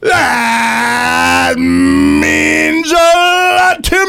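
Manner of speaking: very slow, high, shouted announcer voice; milk each word like a ring announcer. Acted. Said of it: "in his very best announcer voice".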